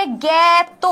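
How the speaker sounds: জ্ঞাত drawn out as "gyæ-to"; syllable pronunciation. Shown as a demonstration. Teacher explaining how it is pronounced, জ্ঞাত as "gyæ-to". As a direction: say জ্ঞাত with a long open "gyæ". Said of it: "এটার উচ্চারণ হচ্ছে".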